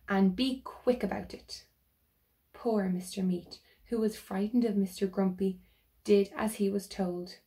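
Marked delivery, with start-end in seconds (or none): none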